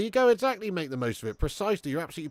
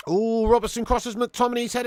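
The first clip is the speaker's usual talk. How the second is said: normal talking voice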